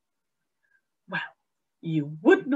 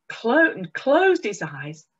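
plain reading voice

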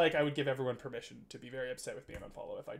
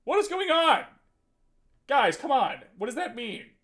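much higher and louder exclamation; baffled outburst; 10 semitones higher, 11 dB louder than usual. Real, not acted. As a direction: protest at a comment that makes no sense. Baffled by chat.

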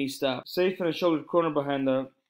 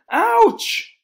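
sudden yelp, much higher and louder; shouted ouch; 11 dB louder than usual. A narrator's reading. Boy jabbed in assembly, yelping loudly. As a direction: cry out as a knife jabs you. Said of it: "Omri shouted".

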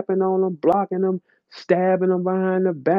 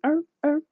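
wind-up toy noise; 12 semitones higher than usual. Retold or imitated.

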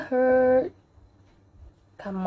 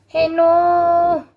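higher, louder protest; alarmed shout; 5 semitones higher, 7 dB louder than usual. Real, not acted. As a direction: cry out to stop it getting away. Piece runs off again.

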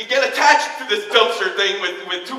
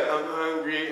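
much softer, lower dazed murmur; dazed mumble; 8 semitones lower, 14 dB quieter, slower than usual. Acted. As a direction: mumble it half asleep. Dazed [name] voiced.

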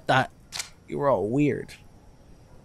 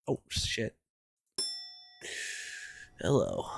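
ordinary talk, nothing marked

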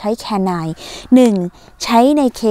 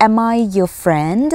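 slow model sentence; much slower than usual. Shown as a demonstration. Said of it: "ถามคนอื่นว่าตัวฉันเป็นหรือเปล่า?".

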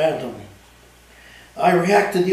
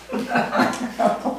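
breathy chuckle; 8 semitones higher, 3 dB quieter than usual. Real, not acted. Narrator laughs at own story.

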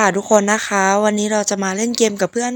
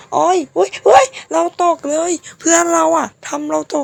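startled; 6 semitones higher, 3 dB louder than usual. Real, not acted. Egg drops in game.

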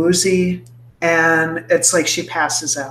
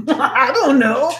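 bewildered; 8 semitones higher than usual. Acted. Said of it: "she's like".

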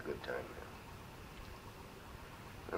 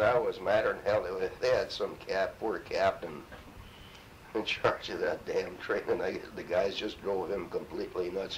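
usual speaking voice

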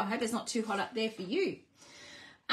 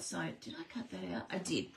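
muttered to herself; 5 semitones lower, 9 dB quieter, faster than usual. Real, not acted. Checking her own cutting aloud.

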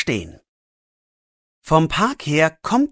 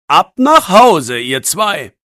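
louder, slow policeman's order; firm send-off; 4 semitones higher, 8 dB louder, slower than usual. Acted. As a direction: shoo the boys home. Policeman sends boys home. (lauter, langsamer Befehl des Polizisten)

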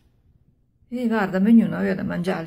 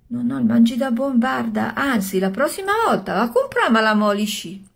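louder, higher eager praise; excited; 4 semitones higher, 5 dB louder than usual. Acted. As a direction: gush over the dinner. Wife eagerly praising the meal.

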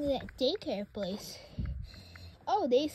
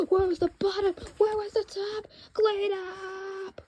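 frantic cleanup panic; 7 semitones higher than usual. Acted. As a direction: rush through it in a panic. Frantic cleaning after the spill.

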